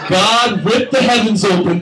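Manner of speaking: normal talking voice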